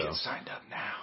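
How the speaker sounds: hushed urgent whisper. Pretend. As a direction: whisper it like a secret message. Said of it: "Why are we whispering?".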